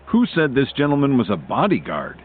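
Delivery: indignant question, mildly cross; furious challenge. A narrator's reading. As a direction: demand an answer with a touch of fury. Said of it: "got a furious look on his face".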